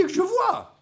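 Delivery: very high, imitated plea; eager cry. Blind man begs Jesus for sight, eager cry.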